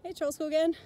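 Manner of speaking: usual speaking voice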